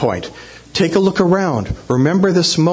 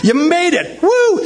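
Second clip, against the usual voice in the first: very high whooping congratulations; celebrating cheer; 13 semitones higher, slower than usual. Retold or imitated. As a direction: whoop it up like the thrilled crowd. Acts crowd celebrating the finish.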